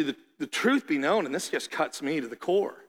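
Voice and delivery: ordinary talk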